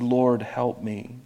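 soft, slow, subdued prayer; hushed, drawn-out appeal for help; understated plea. Acted. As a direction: say it quietly and slowly, holding back. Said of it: "you can put an inflection on it".

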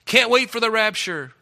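mock scoffer. Mocking the world's scorn.

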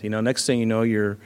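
usual speaking voice

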